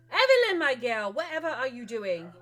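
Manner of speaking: high, loud cry of surprise; startled exclamation. Acted. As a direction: burst in loudly, high and shocked. Fiorenza bursts in on Evelyn.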